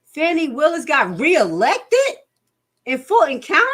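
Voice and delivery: high, loud disbelieving question; real outrage at the news. Real, not acted. Reacting to breaking news.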